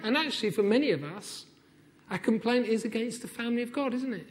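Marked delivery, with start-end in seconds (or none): none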